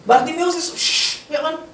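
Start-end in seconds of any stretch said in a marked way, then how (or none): 0.8-1.3 sharp shush; hissed shush. Acted. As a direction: cut someone off with a hush. Shushing Bartimeus.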